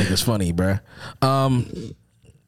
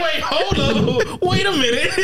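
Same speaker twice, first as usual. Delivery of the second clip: laughing quote; 6 semitones higher, slower than usual. Retold or imitated.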